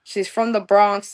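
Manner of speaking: ordinary delivery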